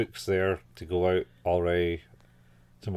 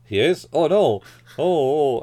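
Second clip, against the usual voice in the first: mock dismay; 6 semitones higher, 5 dB louder than usual. Author realizes he's been overheard.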